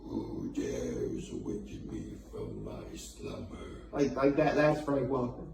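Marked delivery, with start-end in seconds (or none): 0.0-3.6 very deep, slow booming cave voice; Cave of Wonders voice. Retold or imitated. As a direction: rumble it out like a giant stone cave. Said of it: "he was the Cave of Wonders in Aladdin".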